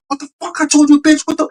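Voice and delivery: high-pitched mock yell at normal volume; imitated crazed yelling. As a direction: mimic someone going crazy yelling. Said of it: "he starts yelling".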